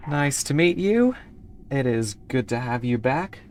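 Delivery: normal talking voice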